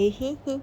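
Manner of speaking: high playful giggle. Real, not acted. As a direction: giggle like a smitten fan. Fan giggles over couple. (ऊँची चंचल खिलखिलाहट)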